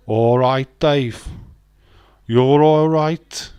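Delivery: loud Brummie, slow last line; mock Brummie, stretched and loud; Birmingham accent. Shown as a demonstration. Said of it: "you get a Birmingham accent".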